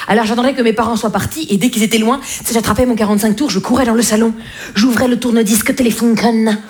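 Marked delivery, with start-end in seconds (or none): none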